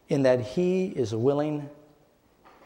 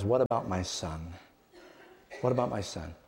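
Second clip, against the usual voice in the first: softer, lower, the father's anxious question; David's quiet worried plea; 5 semitones lower, 6 dB quieter than usual. Acted. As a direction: ask it twice, softer each time. David voiced asking about his son.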